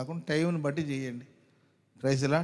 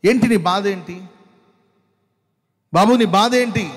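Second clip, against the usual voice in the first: loud, high call to the hurting listener; preacher crying out what is your pain; 6 semitones higher, 12 dB louder than usual. Acted. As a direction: shout the question across the hall.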